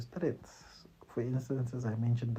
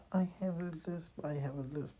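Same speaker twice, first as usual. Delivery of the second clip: higher, softer, apologetic mumble; sheepish repeated "I have a lisp", pitched up and hushed; timid self-put-down; 5 semitones higher, 7 dB quieter than usual. Shown as a demonstration. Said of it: "You can't say".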